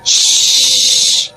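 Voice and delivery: long unvoiced hiss to silence her; witch's hush. Witch hushes girl.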